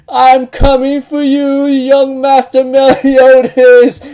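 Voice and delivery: high, loud, drawn-out call; hammed-up slow wail to a beloved master; mock devoted cry. Devoted servant calling out to master.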